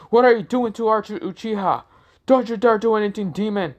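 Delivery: high-pitched indignant villagers; outraged protest. Acted. Crowd protests attack on their Uchiha.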